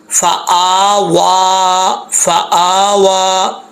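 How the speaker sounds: very slow "फ़आवा", each long vowel stretched; drawn out vowels. Shown as a demonstration. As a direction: stretch the hamza and waw in "फ़आवा". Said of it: "वाव को भी खींचेंगे". ("फ़आवा" को बहुत धीमा, खींचकर)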